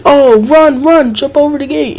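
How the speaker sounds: loud, high panicked yell to run; frantic yelling warning; urgent shout. Retold or imitated. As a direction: call out in alarm to flee. Brother yelling to run from dog.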